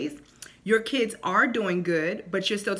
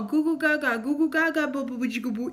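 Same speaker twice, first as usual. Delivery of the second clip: put-on higher cooing baby talk; mock baby talk; 5 semitones higher than usual. Shown as a demonstration. Mother mocking baby talk to her kids, lightly cooing. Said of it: "even though I never did baby talk".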